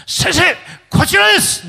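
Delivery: imitated high, loud call of a yakuza underling; shouted greeting. Yakuza underling ushers in the pastor, shouted greeting.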